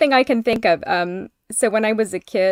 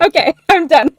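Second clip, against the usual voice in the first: laughing it off; giggling through the words; 9 semitones higher, 8 dB louder than usual. Real, not acted.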